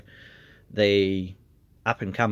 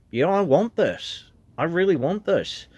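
higher and eager, retelling his own resolve; eager declaration; 7 semitones higher than usual. Retold or imitated. Recalling his first step in, eager self-quote. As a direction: say it like you're claiming your dream. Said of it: "kicked that door in and went".

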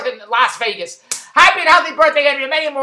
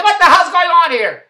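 loud, higher outraged complaint; indignant outburst; 5 semitones higher, 6 dB louder than usual. Retold or imitated. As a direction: demand an explanation at the desk. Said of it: "I said".

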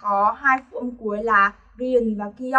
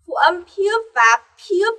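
ordinary talk, nothing marked

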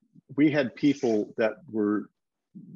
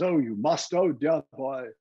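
put-on British accent, theatrical gushing; Sir John; 4 semitones higher than usual. English knight recommending Guys and Dolls, effusive British accent. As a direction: imitate an English actor raving about a show. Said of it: "And Sir John told me, he says".

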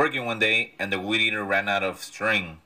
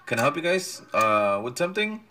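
helpful stranger; 7 semitones higher than usual. Retold or imitated. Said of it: "he's like".

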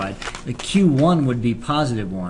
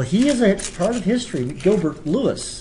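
put-on southern accent. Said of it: "I do like my southern accent".

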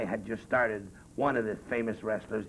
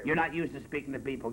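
normal talking voice

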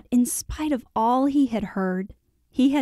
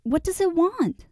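high-pitched eager question; boy's high cry of curiosity; 9 semitones higher than usual. A narrator's reading. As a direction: ask in high, urgent wonder. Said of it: "cried Colin".

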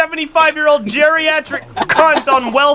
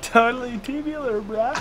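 much softer copy of a catchphrase; subdued echo; 10 dB quieter than usual. Retold or imitated. Player echoing a teammate's catchphrase, subdued. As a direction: repeat it back under your voice, softly.

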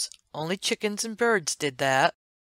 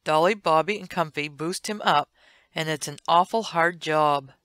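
plain reading voice

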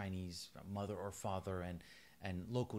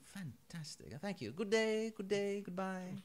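mock upper-class Englishman, pitched higher; Fleming impression; 10 semitones higher than usual. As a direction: ham up a fussy posh gentleman. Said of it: "he's like".

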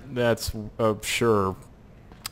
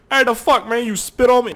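high, loud put-on protest; indignant game bystander; 12 semitones higher, 7 dB louder than usual. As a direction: play an outraged onlooker, pitched way up. Voices the bystander spat upon.

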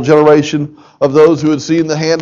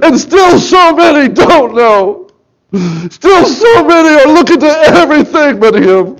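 emotional, near tears; 11 semitones higher, 5 dB louder than usual. Real, not acted. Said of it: "I'm emotional about this today".

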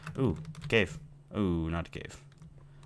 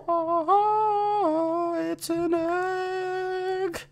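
squeaky high voice; much slower than usual. Acted. Excited about finding an egg.

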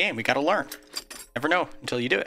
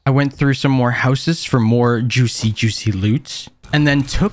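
louder, steady recap narration; voiceover narration; 6 dB louder than usual. A narrator's reading. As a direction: narrate the day like a video recap.

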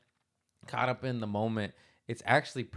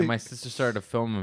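ordinary delivery

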